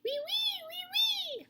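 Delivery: very high whining wee wee; mosquito whine. Acted. Reading to children, high mosquito whine. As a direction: squeak it like a tiny mosquito.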